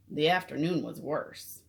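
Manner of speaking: plain reading voice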